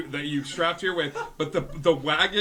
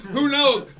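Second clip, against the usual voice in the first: character voice; 6 semitones higher, 4 dB louder, faster than usual. Acted. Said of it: "So he goes".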